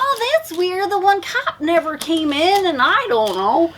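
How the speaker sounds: high mock dopey cop voice; clueless cops. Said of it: "They're like".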